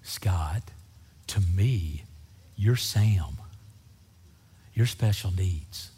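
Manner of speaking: low, slow, solemn address with long pauses; God speaking. Retold or imitated. God telling Scott to love people, solemn and low. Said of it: "because God said".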